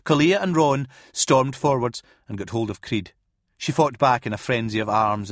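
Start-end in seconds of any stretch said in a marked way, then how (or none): none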